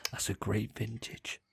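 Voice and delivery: whispered connoisseur's appraisal; mock-reverent whisper; imagined drink taster. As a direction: play a hushed taster savouring it slowly. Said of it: "imagine a person that's like".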